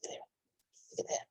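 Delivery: hushed and slow; slow whisper. Real, not acted. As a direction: draw it out in a whisper. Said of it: "reader whispers aside".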